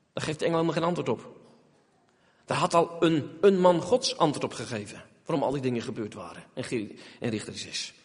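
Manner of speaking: ordinary talk, nothing marked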